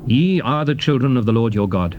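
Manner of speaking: usual speaking voice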